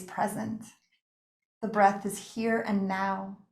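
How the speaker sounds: calm meditation guide with long pauses between phrases; unhurried, settling yoga-nidra voice; guided meditation. Real, not acted. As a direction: guide them gently into rest, phrase by phrase. Leads a guided relaxation.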